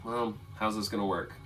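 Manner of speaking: low, soft aside to oneself; thinking aloud. Real, not acted. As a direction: work it out under your breath. Game master thinks aloud to himself.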